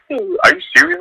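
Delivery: very high, amazed disbelief; mock stunned fan. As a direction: gasp it out in disbelief.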